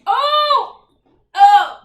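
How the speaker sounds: loud, high disgusted outburst; disgusted cry. Real, not acted. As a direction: recoil with a laughing yelp. Tasting Vegemite, disgusted.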